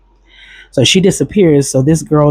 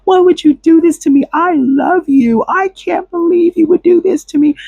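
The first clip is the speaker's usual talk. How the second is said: mock fake sobbing plea. Girlfriend pleading in front of the cops, hammed-up sobbing. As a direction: overplay her fake tearful pleading. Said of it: "out here crying".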